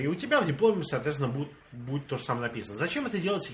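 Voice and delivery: usual speaking voice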